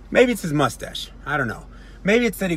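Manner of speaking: ordinary talk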